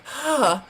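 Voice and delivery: "huh" as a long breathy exhale; sound of H. Shown as a demonstration. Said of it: "Not the sound".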